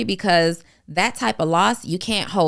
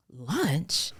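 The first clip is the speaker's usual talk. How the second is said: incredulous mom; 6 semitones higher than usual. Retold or imitated. Imitating her mom, shocked she wants lunch after the loss, incredulous. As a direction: play the mom who can't believe her ears. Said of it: "my mom is like".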